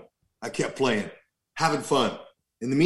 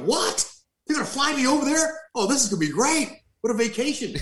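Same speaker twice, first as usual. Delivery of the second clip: high, excited imitation of his younger self; giddy disbelief; 9 semitones higher than usual. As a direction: replay the thrill in a higher voice. Retelling his own excited reaction.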